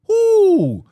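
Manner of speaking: loud, high drawn-out "ooh"; high whooping ooh. Real, not acted. Preacher exclaims at hidden evil.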